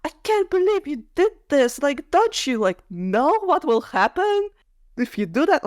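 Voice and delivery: high, scolding mock Lucien voice; imitating a huffy, scolding guy; exasperated Lucien. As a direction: pitch it up and nag like an indignant friend. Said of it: "Lucien at times was just like".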